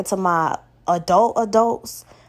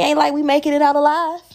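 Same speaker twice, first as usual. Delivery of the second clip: usual speaking voice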